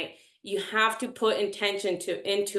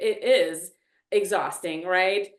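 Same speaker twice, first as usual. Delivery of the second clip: ordinary talk, nothing marked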